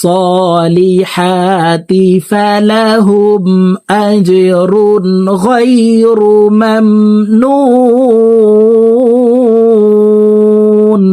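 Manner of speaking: slow chanted verse, long held end; one breath, melodic tajweed; full verse recitation. Shown as a demonstration. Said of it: "এক টানে বলে দিচ্ছি". (এক টানে সুরেলা তিলাওয়াত)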